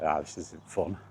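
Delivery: usual speaking voice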